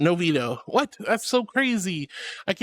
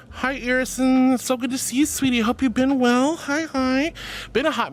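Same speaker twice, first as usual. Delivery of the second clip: gushing greeting; 6 semitones higher than usual. Real, not acted. Streamer welcoming a returning viewer, gushing and high. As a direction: greet a dear regular in a raised, delighted voice.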